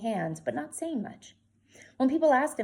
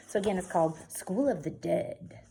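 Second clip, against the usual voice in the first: plain reading voice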